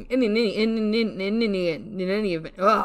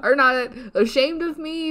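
normal talking voice

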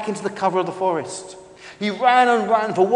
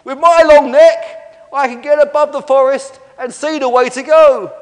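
high, loud, sustained calling; faraway shout; 9 semitones higher, 12 dB louder than usual. Acted. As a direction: call down from far above. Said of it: "in a faraway voice".

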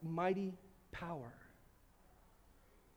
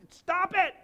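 higher, louder stop it; loud rebuke; 8 semitones higher, 9 dB louder than usual. Real, not acted. Pastor cutting off the self-pity with a loud stop. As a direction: snap them out of it loudly.

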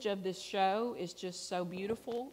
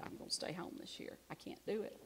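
quiet; 11 dB quieter than usual. Retold or imitated.

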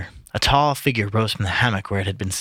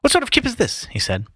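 high-pitched huffy complaint; sudden pet; 10 semitones higher, faster than usual. A narrator's reading. Mulligan finding no milk, in a sudden pet. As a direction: complain in a petulant fit. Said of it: "sat down in a sudden pet".

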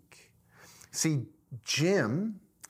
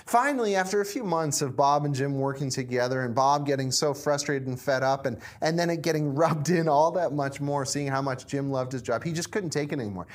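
ordinary talk, nothing marked

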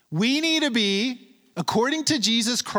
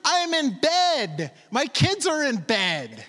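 frustrated neighbor; 6 semitones higher, slower than usual. Retold or imitated. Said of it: "You can tell he's frustrated".

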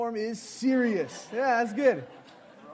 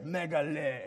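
normal talking voice

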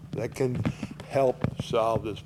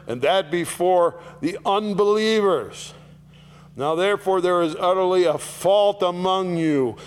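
higher, louder scripture proclamation; full-force preaching of the verse; 5 semitones higher, 5 dB louder than usual. Acted.

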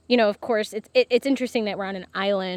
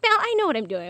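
confident mom; 10 semitones higher, 6 dB louder, faster than usual. Retold or imitated. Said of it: "And she's like".

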